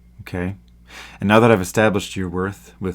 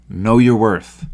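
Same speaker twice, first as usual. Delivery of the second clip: forceful command; 7 dB louder, slower than usual. Real, not acted. Closing rallying command.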